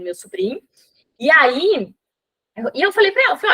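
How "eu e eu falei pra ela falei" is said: ordinary talk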